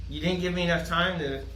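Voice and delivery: ordinary talk, nothing marked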